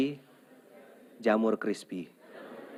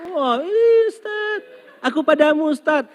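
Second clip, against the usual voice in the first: very high, loud gushing; gushing fan; 10 dB louder than usual. Retold or imitated. Delighted husband adoring the ustaz, very high. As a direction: swoon at the preacher.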